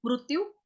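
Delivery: मृत्यु as mrutyu; Marathi-style vowel. Shown as a demonstration. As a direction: use a u after mr in मृत्यु. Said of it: "वो मृ बोलेंगे".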